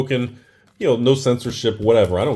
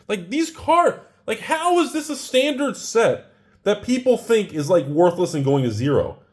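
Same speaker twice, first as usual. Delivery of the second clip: high-pitched amazed disbelief; excited; 8 semitones higher than usual. Real, not acted. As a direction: rattle it off, astonished. Amazed by pack contents.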